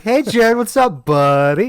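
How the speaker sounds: put-on loud, high, drawn-out drunken greeting; drunk commander. Commander greeting the gate guard late at night, drunk. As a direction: imitate a boisterous drunk hailing a pal. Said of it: "I'm drunk".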